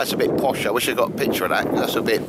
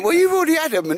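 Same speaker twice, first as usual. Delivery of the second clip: much higher put-on voice, teasing; mock scolding retort; 4 dB louder, faster than usual. Telling colleagues they'd already had the meatballs, high mock scolding. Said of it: "And I went".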